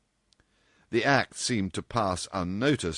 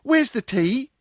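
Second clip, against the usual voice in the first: put-on eager girl's voice; 7 dB louder than usual. Girls greet Dorothy wanting tea.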